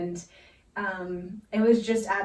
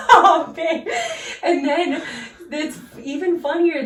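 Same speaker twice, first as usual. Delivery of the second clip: high, loud laughter through the words; cracking up while trying to talk; laughing hard at her own story; 8 semitones higher, 5 dB louder than usual. Real, not acted.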